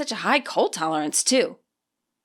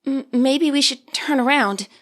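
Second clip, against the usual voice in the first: slow, higher, stammering start; nervous stammer; 4 semitones higher than usual. Acted. Penny urging Ortega to turn back, nervous stammer. Nervous among staring ghosts.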